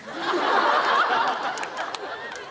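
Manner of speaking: breathy high laugh. Real, not acted. Recalling Thomas charging in, breathy laughter. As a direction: laugh helplessly at the memory.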